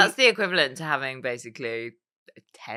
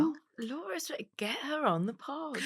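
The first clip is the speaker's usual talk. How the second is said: gushing enthusiasm; 10 semitones higher, 9 dB quieter than usual. Real, not acted. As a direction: coo over someone you adore. Gushing over a guest idea.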